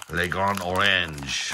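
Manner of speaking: slow, drawn-out mock French accent; hammy French accent, dragged out slowly; bad French accent. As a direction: put on a really bad French accent, stretching the words. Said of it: "really bad French accent".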